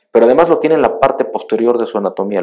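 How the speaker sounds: loud, high sermon voice; building to full force at the pulpit; fiery preaching. Acted. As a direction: preach it out to the congregation. Preacher raises voice on scorpions.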